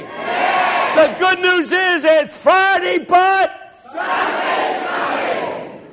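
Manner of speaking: very slow, high, drawn-out shout; climactic preaching shout. Real, not acted. Preacher delivers the good news to the church, slow high shout. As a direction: roar the final refrain to the crowd.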